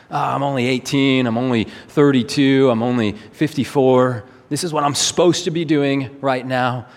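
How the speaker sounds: ordinary talk, nothing marked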